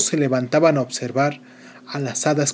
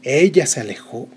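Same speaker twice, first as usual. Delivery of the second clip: usual speaking voice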